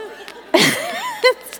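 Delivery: breathy high-pitched giggle; high laugh. Real, not acted. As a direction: let a high giggle escape. Laughing at the drive-thru story.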